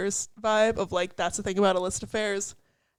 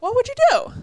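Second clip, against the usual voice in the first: playful echo; 7 dB louder than usual. Real, not acted. Echoing friend's question playfully.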